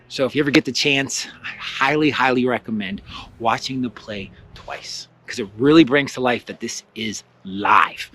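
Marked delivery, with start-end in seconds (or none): none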